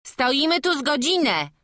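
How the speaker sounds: put-on loud, high child voice; village children. A narrator's reading. Several children answer the searching villagers, high loud piping. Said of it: "odezwało się kilkoro malców".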